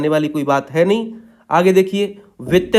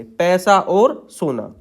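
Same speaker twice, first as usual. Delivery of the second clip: पैसा और सोना said slowly once more; slow dictation; much slower than usual. Shown as a demonstration. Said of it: "और सोना के लिए सा से काटेंगे". (धीमी गति से बोलना)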